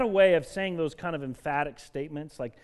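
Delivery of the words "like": ordinary talk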